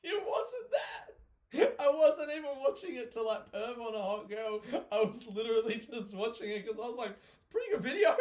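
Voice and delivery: squealing high, voice cracking with laughter; helpless laughter. Real, not acted. Explaining why they watched it, helpless with laughter. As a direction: protest through a laughing fit.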